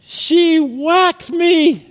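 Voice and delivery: put-on high, slow, loud wailing child; little brother tattling on sister; crying little boy. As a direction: whine it like a hurt toddler. Crying child voiced in story.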